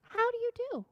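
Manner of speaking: high, quiet, tiny kitten voice; polite little kitten. Acted. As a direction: greet her sweetly like a tiny kitten.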